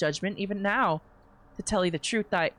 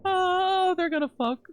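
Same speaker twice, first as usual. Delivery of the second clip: high held "oh", sliding down; giddy squeal; 11 semitones higher, 3 dB louder than usual. Real, not acted. Reader sure of coming romance, giddy high squeal. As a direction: squeal it, then descend into the words. Excited reaction to the scene.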